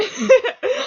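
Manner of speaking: high loud burst of laughter; laughing at herself for crying; embarrassed laugh. Real, not acted. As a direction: giggle it off. Laughs about crying at concert.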